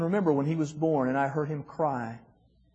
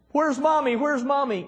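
put-on high, small child asking for mom; little boy voice; 11 semitones higher than usual. Father imitating his young son, high child voice. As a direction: pitch way up like a toddler. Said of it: "No, none of that".

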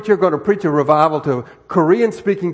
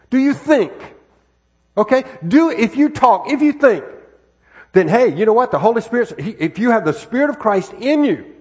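loud, driving preacher's push; full-force preaching; 3 semitones higher, 6 dB louder than usual. Real, not acted. Preacher urging the congregation, loud and driving.

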